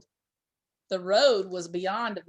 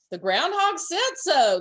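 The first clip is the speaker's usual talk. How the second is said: louder, slow, bouncy call; excited cry; 6 semitones higher, 5 dB louder than usual. A narrator's reading. Dormouse dancing in snow, crying out gleefully. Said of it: "the dormouse cried".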